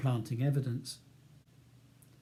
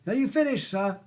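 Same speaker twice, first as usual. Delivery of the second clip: raised high call from outside; distant call; 8 semitones higher, 4 dB louder than usual. A narrator's reading. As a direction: call back through the door. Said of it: "a voice calls".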